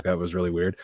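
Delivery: ordinary talk, nothing marked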